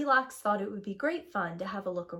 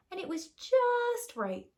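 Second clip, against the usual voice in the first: high, slow and delighted; just right; 11 semitones higher than usual. A narrator's reading. Narrator relishing the perfect porridge, high and slow. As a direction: stretch it out up high, pleased.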